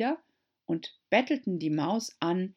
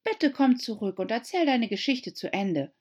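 calling after; 6 semitones higher, 3 dB louder than usual. A narrator's reading. Alice calling after the mouse. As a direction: call out pleading. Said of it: "rief Alice ihr nach".

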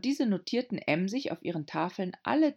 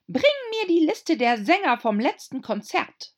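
high, loud imperious command; haughty queen commands; 11 semitones higher, 8 dB louder than usual. A narrator's reading. Queen orders.

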